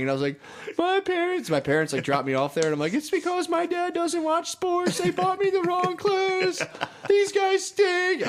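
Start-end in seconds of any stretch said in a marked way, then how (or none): 0.8-1.5 high-pitched mock child wail; mock crying. A boy retelling his tearful complaint, mock crying. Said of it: "I just started crying". 2.9-8.3 put-on slow, very high-pitched childish wail; mock bawling. A little kid blaming his parents over the jacket, mock bawling. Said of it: "I was crying my eyes out".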